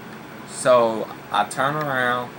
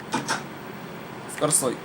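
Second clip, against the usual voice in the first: whispering; 2 semitones higher, 8 dB quieter, much slower than usual. Real, not acted. Muttering after the cat interrupts.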